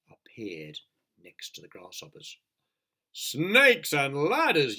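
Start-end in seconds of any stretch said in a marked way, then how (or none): 3.1-4.8 slow, loud panicked yell; a fire chief yelling in panic. Acted. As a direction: bellow it out in alarm. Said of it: "yelled the head".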